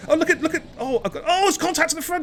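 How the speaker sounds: high, loud excited race call; excited commentary. Real, not acted. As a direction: call the action at fever pitch. Frantic live race commentary.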